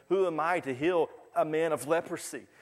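a king freaking out over the letter; panicking king. Retold or imitated. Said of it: "He panics".

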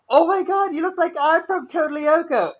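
high, loud squeal of fan excitement; fangirl freakout. Retold or imitated. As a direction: gush like you just met your idol. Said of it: "I started freaking out".

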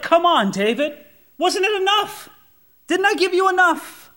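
high, incredulous reproach; imitating a hurt, exasperated appeal; exasperated God. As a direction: plead in disbelief, pitch way up. Voices God reproaching David.

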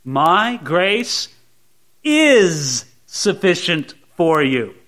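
IS stretched long after a pause; pause, then hit IS hard and slow; slow, deliberate emphasis on IS; stress on IS. Shown as a demonstration. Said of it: "Take another one".

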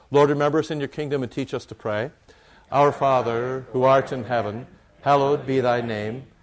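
loud, measured unison recitation; congregational prayer. Acted. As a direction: lead the prayer aloud with full voice. Leads the Lord's Prayer.

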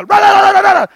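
high, loud rah-rah tirade; mock rant. Barging in on Sandy, loud mock ranting. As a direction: barge in babbling at her. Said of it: "you go".

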